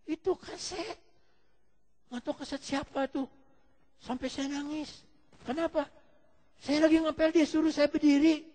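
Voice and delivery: high-pitched tearful explanation, acted; imitating a crying housemaid. Weeping housemaid explains the tape to her employer, mock tearful. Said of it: "Kenapa kamu nangis?".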